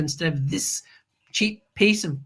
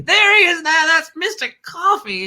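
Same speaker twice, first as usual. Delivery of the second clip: excited reveal; 9 semitones higher than usual. Real, not acted. Host shows the audience the coffee maker's photo, excited. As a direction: present him like a proud showman. Showing Mister Coffee picture.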